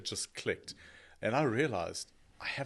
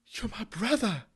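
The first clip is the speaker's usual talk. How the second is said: mock tearful plea, higher pitch; imitating crying brother; 12 semitones higher, 4 dB louder than usual. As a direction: play the weeping half-brother at the funeral, lightly. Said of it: "and he starts crying".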